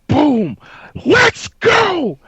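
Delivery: high, loud victory yell; retold hype scream. Player heard going off after the kill, hyped yell. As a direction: belt it out like the winning play just landed. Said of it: "all you hear was".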